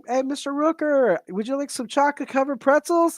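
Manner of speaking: high, eager call to get someone's attention. Retold or imitated. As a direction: pitch it up like hailing a celebrity. Retold call to a celebrity.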